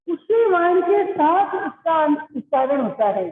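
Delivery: ordinary talk, nothing marked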